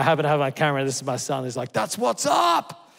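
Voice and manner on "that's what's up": high, slow whooping shout; a hyped young man shouting his approval; imitating son yelling in triumph; son's cheer.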